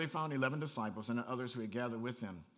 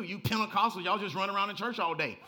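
teasing Baptist; 4 semitones higher, 7 dB louder than usual. Retold or imitated. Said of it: "they get on us".

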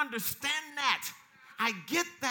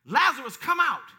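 sudden raised call after hushed telling; retold scream; 8 dB louder than usual. Jesus calling Lazarus from the tomb, retold shout. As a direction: shout it out to summon someone. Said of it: "screamed, he shouted at Lazarus".

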